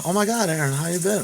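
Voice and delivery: high, bubbly mock greeting; excited greeting. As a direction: imitate her gushing hello.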